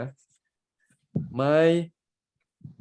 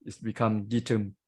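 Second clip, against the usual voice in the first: normal talking voice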